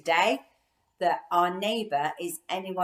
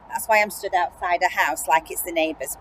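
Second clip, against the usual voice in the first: ordinary delivery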